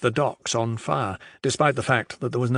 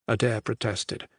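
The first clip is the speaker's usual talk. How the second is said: ordinary delivery, nothing marked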